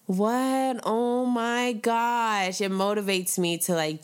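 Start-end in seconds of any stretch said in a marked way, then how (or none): 0.0-2.6 high, stunned "what, what"; flustered surprise. Retold or imitated. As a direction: caught off guard by a compliment, high and slow. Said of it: "I'm just like".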